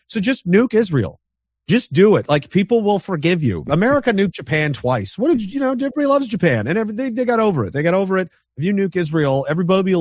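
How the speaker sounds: high, eager sarcastic urging to Iran; mock pep talk. Sarcastically urging Iran's leaders to strike Israel, in a high, egging-on voice. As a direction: egg them on with exaggerated cheer.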